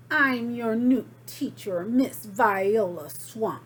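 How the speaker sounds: loud, slow and menacing; stern witchy teacher. Acted. Miss Swamp greeting the class, loud and stern. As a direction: announce yourself like a nasty teacher.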